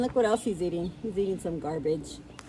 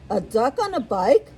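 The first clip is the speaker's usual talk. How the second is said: high, loud amused cow voice; incredulous storybook cow, climbing in pitch; cow character; 12 semitones higher, 11 dB louder than usual. A narrator's reading. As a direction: rise into high-pitched disbelief at something silly. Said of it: "But what she thought was".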